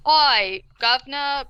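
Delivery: put-on British-meant accent gone Australian; bad Australian accent. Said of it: "is definitely Australian".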